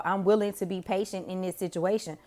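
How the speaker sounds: ordinary delivery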